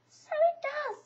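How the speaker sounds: small high squeaky Piglet. A narrator's reading. Narrator reading, Piglet answers Eeyore timidly.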